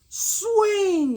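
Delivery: very high squeaky yell; yelled swing. A narrator's reading. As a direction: shriek the order to swing. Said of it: "yelled Kevin".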